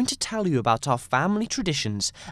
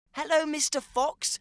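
cat greeting; 10 semitones higher than usual. Acted.

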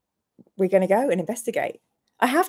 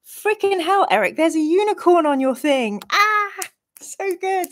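excited; 9 semitones higher than usual. Real, not acted. Excited about a donation.

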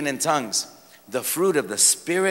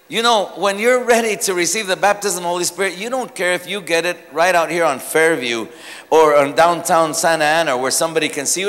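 normal talking voice

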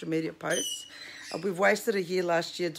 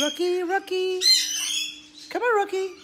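much higher, coaxing call; sing-song coax to get the parrot moving; coaxing bird; 11 semitones higher, 4 dB louder than usual. Real, not acted. Owner coaxes Rocky off her shoulder in a high call.

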